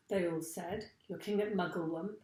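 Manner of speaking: ordinary delivery, nothing marked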